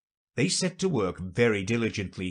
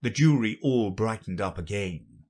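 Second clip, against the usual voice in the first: plain reading voice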